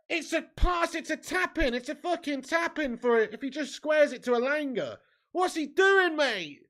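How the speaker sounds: high, loud exasperated shouting; angry ranting. Real, not acted. Fan ranting at the screen over a missed tap-in, loud exasperated shout. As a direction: rage that he didn't square it. Fan rants about selfish shot.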